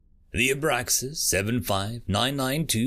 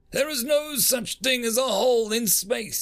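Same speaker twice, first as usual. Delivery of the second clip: desperate shout; 13 semitones higher than usual. A narrator's reading. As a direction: shout it to cling to normal. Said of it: "desperately reaching for a sense of normalcy".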